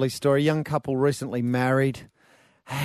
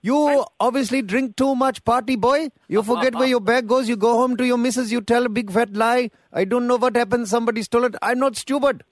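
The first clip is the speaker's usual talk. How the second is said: put-on higher, fast accented tirade; mock angry rant; 11 semitones higher, 7 dB louder than usual. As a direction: scold the party boy, pitch up. [name] rants at caller.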